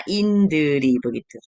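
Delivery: "Indri" drawn out slowly as in-deu-ri, syllable by syllable; Korean-style Indri. Shown as a demonstration. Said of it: "Tulisan begini ya". ("Indri" diucapkan pelan ala Korea)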